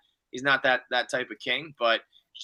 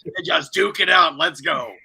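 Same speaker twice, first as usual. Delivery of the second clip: loud, rising hype yell; hype shout; 7 dB louder than usual. Real, not acted. Cheering on the two queens to fight, a loud hype yell. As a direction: whip the room up for a brawl.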